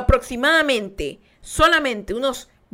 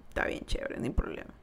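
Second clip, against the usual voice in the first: susurro resignado; 12 dB quieter than usual. Retold or imitated.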